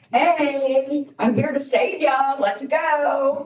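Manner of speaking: high, bright heroic rescuer call; mock rescuer. Speaker mocking the idea of a rescuer: hammed-up heroic call. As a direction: play the rescuer who crashes in to save her. Imagined rescuer bursting through window.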